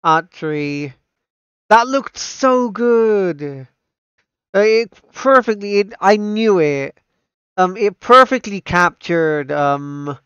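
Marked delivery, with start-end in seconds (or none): 1.7-7.0 high, drawn-out rave; awed gushing. Real, not acted. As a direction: rave slowly in a raised pitch, thoroughly impressed. Gushing right after watching the trailer.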